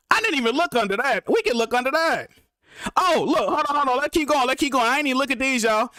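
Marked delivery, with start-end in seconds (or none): none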